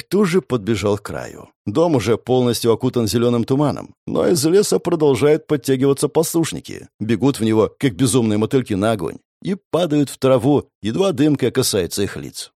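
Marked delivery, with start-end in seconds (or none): none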